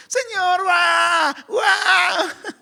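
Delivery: put-on high wailing baby-like bawl; exaggerated sobbing call to God; hammed-up "waah" wail; mock crying. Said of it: "cuánto va a llorar este".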